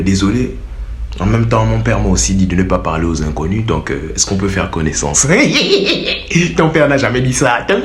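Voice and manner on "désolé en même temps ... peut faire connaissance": low, polite demonstration voice; smooth chat-up line. Shown as a demonstration. Suitor asking to get acquainted, mock-smooth. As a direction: drop low and ask politely, near laughter. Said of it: "Tu lui dis".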